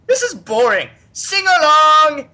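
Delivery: a bored god barking a sing-along order; Nurgle's bored command. Retold or imitated. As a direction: Nurgle demands a song from the Seers, loud and drawn-out. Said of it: "And eventually he's like".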